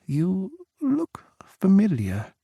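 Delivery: high, slow unvoiced whisper; tipsy whisper. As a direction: lean in and breathe it secretly. Tipsy woman peering.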